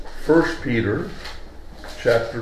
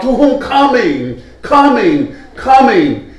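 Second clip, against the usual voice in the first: "coming" repeated higher, each drawn out and falling; insistent stress on coming; 6 semitones higher, slower than usual. Shown as a demonstration. Preacher stresses Peter's ongoing word, insistent on "coming". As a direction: hammer "coming" each time as a separate beat. Contrasts coming with having come.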